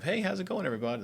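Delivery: bright, high hello to the room; cheery group greeting. Retold or imitated. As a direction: call out a big friendly hello. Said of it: "surface level group setting of".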